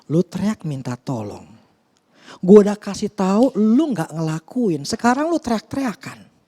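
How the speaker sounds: high-pitched exasperated reproach; everyday-language scolding. Retold or imitated. God to someone who ignored His advice, exasperated. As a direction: imitate a fed-up God telling them off. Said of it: "secara bahasa sehari-hari kayak gitu".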